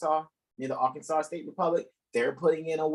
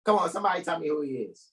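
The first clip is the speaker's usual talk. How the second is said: ordinary talk, nothing marked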